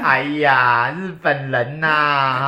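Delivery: loud, drawn-out mock sigh of realisation; mock exclamation. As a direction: stretch it out with theatrical exasperation. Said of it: "对啊，你就看".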